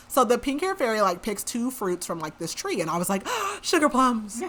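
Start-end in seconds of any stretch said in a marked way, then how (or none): 3.4-4.4 gasp, then a higher delighted exclamation; mock-gasping delight, pitched up; excited recognition. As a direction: playfully re-enact a thrilled discovery. Gasped recognition of the fruit.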